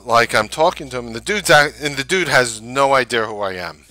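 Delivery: ordinary talk, nothing marked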